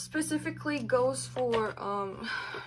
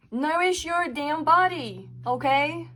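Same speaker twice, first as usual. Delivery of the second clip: slow, higher, louder scolding shout; yelling; 6 semitones higher, 7 dB louder, slower than usual. Real, not acted. Reader scolding viewers to nourish their bodies, slow loud yell. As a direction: bark it out slowly, fed up. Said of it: "I'm sorry for yelling at you".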